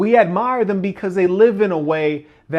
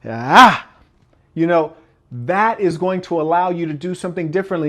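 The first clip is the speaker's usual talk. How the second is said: normal talking voice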